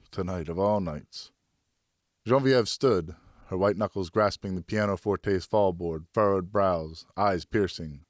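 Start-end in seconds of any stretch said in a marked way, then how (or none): none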